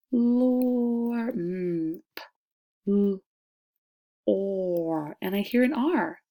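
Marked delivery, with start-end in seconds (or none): 0.1-5.2 P-lore split into sounds p, l, or; teacher segmenting P-lore sound by sound. Shown as a demonstration. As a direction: sound out each letter slowly. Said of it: "The next syllable is explore".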